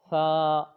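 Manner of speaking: ثا loud with the tongue out; a clear recited ثا; demo letter. Shown as a demonstration. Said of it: "جیسے آپ نے اوپر والا یہ حرف پڑھا تھا". (ثا زبان دانتوں سے نکال کر)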